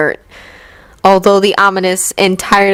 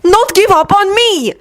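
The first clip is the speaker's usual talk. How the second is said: hurt plea; 13 semitones higher than usual. Acted. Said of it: "Victor answered immediately".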